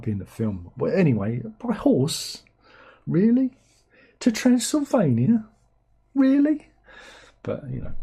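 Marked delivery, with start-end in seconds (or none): 1.6-6.7 incredulous. Retold or imitated. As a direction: play a rising exasperated really. Mocking Harker riding to Transylvania.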